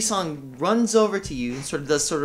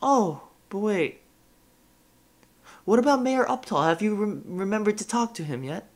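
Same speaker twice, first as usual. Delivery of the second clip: giggly ditzy; 7 semitones higher than usual. Acted. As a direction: giggle it out, forgetful. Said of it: "She sort of giggles to herself".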